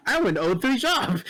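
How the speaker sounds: high, loud incredulous cry; near-laughing outburst at losing; disbelieving outcry. Retold or imitated. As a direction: shout it in stunned disbelief.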